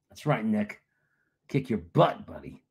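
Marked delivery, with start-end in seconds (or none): none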